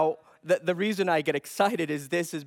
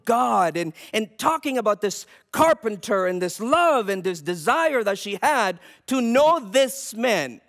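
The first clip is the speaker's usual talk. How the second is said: loud, high, driving sermon voice; preaching at full force; 6 semitones higher, 6 dB louder than usual. Acted. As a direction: preach it hard to the congregation. Preaches on Peter's warning.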